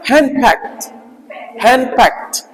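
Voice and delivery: हैंड फैक्ट with a light t closing it; light final T. Shown as a demonstration. Teacher introducing a word to students, हैंड फैक्ट with light final T. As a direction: model हैंड फैक्ट twice, ending in a light t. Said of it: "इसको ट नहीं पढ़ेंगे".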